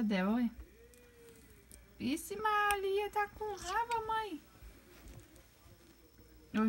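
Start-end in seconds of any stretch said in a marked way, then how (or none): 2.0-4.4 high, slow drawn-out pet voice; high slow cooing "Vixe Maria"; baby talk mock alarm. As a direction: pretend shock at the angry bird. Mock alarm at angry bird.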